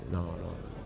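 low, soft dealer's patter; drug dealer. Acted. Musician mimics a dealer pushing his goods, low and soft. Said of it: "è come uno spacciatore".

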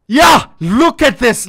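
loud and slow, hyped up; excited. Real, not acted. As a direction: show it off with excitement. Excited showing a pattern.

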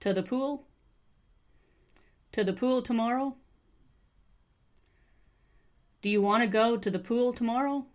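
"To" said as a weak "ta"; reduced to. Shown as a demonstration. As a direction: model question with "to" as "ta". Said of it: "to is almost always ta".

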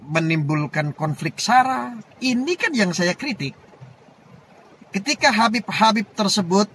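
high, forceful orator's delivery; impassioned oratory. Real, not acted. Defending his criticism to Cemani, high impassioned oratory. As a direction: drive each point home, pitched high and emphatic.

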